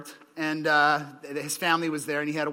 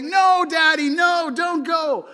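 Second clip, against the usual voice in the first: put-on high, loud pleading little boy; crying child; 11 semitones higher, 10 dB louder, slower than usual. Little boy at the airport begs his dad, high loud wail. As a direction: wail like a three-year-old clinging to dad's leg. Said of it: "he's like".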